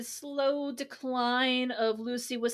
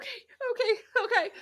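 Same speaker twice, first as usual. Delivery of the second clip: high giggly okay; laughing still; 11 semitones higher, much faster than usual. Real, not acted. Calming the co-hosts down while still laughing, giggly. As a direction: try to stop laughing and fail.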